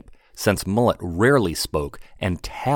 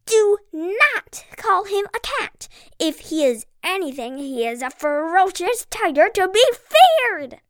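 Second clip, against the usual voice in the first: very high tiny pixie, indignant; small pixie voice. Acted. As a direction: squeak it out proudly like a tiny pixie. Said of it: "said a small pixie".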